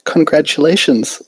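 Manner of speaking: shy pony, sweetly polite; Fluttershy voice. A narrator's reading. Fluttershy greets her opponent.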